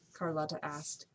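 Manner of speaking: ordinary delivery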